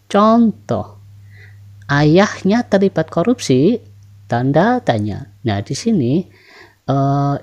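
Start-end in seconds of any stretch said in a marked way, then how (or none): 1.9-3.8 "Ayahnya terlibat korupsi" pitched high, as a yes-no question; rising question intonation. Shown as a demonstration. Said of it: "bagaimana intonasi naik".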